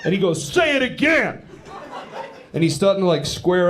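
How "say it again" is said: high, drawn-out aggressive demand; mock angry challenge. Comic voices his buddy squaring up to him, mock fury. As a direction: imitate a riled-up guy, pitch way up. Offended friend demands a repeat.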